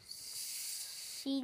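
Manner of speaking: slow hushed whisper. Real, not acted. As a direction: whisper it like a secret. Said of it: "you can just whisper the bad word".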